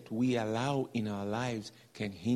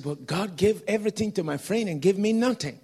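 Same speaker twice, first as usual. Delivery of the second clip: higher, louder indignant protest; indignant complaint; 7 semitones higher, 6 dB louder than usual. Retold or imitated. Preacher voicing the angry man's protest, loud and high. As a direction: complain loudly that it's unfair.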